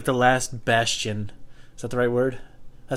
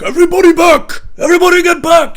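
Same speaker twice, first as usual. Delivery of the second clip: much higher, much louder bellow; mock Viking battle cry; commanding shout; 13 dB louder than usual. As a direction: order the crowd back like a warrior. Viking headliner breaking up fight.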